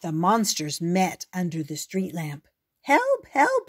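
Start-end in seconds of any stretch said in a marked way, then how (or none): 2.8-3.7 very high, loud frightened squeak. Acted. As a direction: squeak for help like a scared mouse. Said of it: "squeaked one of them".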